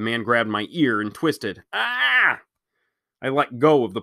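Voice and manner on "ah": cry of pain. Acted. Man twists his ear.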